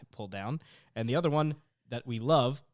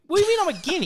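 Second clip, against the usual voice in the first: high, loud, outraged question; indignant disbelief; 11 dB louder, much faster than usual. Retold or imitated. Retelling her reply to a teasing coworker, shocked and high. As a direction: act stunned and offended at the label.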